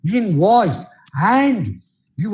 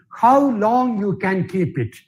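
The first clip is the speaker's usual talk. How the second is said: ordinary talk, nothing marked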